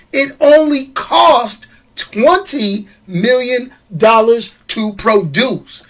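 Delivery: slow, deliberate delivery of the figures; slow emphasis. Real, not acted. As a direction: spell out the cost word by word. Slow emphatic take, then repeated plainly.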